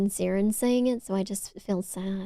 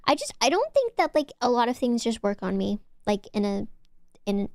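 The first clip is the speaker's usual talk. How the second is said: ordinary delivery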